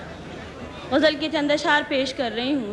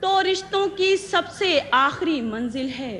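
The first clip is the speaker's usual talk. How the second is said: measured verse, repeats lifted higher; ghazal recitation; 6 semitones higher than usual. Acted. Poet presenting her ghazal to the gathering, measured recitation. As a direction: recite each line deliberately, pausing between phrases.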